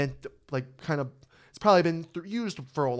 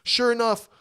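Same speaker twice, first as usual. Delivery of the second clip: ordinary talk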